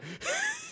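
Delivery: very high, thin wheezing noise; mock wheeze. Host mimics sick woman running, mock wheeze. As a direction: imitate a wheezing runner. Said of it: "she's probably wheezing".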